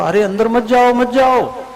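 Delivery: high, urgent call to stop; warning call. Acted. Krishna calls his friends back from the cave, urgent high call. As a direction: call out to stop them going in.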